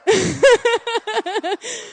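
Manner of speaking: high chuckling laugh. Real, not acted. Preacher joking about her absent husband, laughing. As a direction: laugh in quick bursts.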